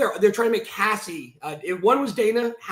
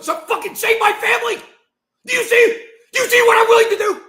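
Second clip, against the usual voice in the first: high, loud, desperate yelling; real angry shout; 7 dB louder than usual. Real, not acted. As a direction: yell it out, losing control. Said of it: "I'm gonna calm down".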